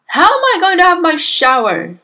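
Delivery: loud, high complaint; indignant demand. A narrator's reading. Husband stuck in hat before bed, demanding. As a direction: demand an answer, exasperated. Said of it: "he demanded".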